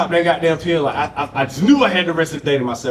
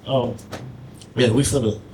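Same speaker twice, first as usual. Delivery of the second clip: ordinary talk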